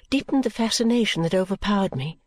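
plain reading voice

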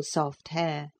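plain reading voice

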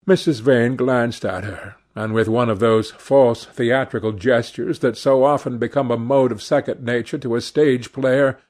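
usual speaking voice

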